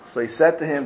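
ordinary delivery

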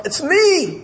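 high-pitched, desperate plea; desperate cry. Acted. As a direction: cry out to be recognized, pitch leaping up. Rejected son cries out.

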